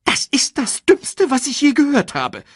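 hoch, langsam und verächtlich; fauchend. A narrator's reading. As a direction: Timon verhöhnt die Idee. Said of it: "fauchte Timon". (hohes, spöttisches Fauchen)

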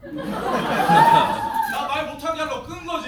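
drawn-out words through laughter; laughing. Real, not acted. To the audience about the switched-off mic, slow and laughing. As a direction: laugh it out slowly, amused at himself.

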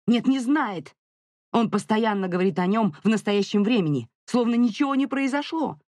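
high, indignant contradiction; frustrated wife arguing; heated argument. A narrator's reading. Mother quarrels with husband.

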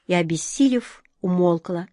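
plain reading voice

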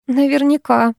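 mildly sarcastic agreement; wry snort. A narrator's reading. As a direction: echo it with a dismissive little huff. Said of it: "хмыкнула я".

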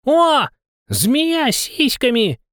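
high, loud, gleeful penguin cry; delighted exclamation. A narrator's reading. Penguin cheering from far behind at the snake-maiden, delighted high cry. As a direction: crow it out, high and drawn-out. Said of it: "восторженный возглас пингвина".

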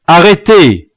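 high, loud order to halt; shouted command. A narrator's reading. Chief halting an execution, shouted command. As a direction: stop them with one shout.

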